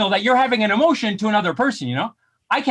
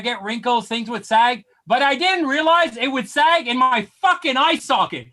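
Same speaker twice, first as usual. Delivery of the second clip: ordinary talk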